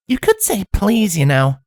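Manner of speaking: blunt, snarky filly voice. Acted. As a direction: needle her bluntly. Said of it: "Twilight snarked out bluntly".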